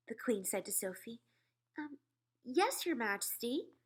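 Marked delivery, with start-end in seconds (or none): none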